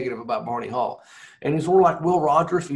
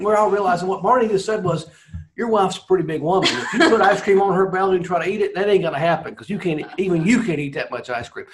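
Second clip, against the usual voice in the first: raised voice, laughing through the punchline; laughing while explaining; 5 semitones higher, 5 dB louder than usual. Real, not acted. Telling the host the joke, laughing through it. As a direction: laugh through it, louder and higher.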